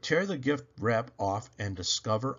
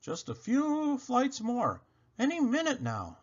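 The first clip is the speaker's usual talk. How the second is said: put-on boy's voice; 12 semitones higher than usual. A narrator's reading. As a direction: keep the boy urging on. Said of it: "I shout".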